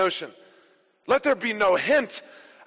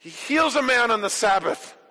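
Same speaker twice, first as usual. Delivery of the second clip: ordinary talk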